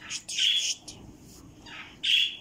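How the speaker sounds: short sharp shh; quick shushing. Real, not acted. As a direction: hush it with clipped shh sounds. Shushing the bird.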